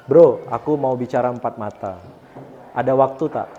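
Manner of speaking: low, gentle and friendly ask; polite calm request. Shown as a demonstration. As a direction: ask a friend kindly for a private talk. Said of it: "Orangnya itu berbicara baik".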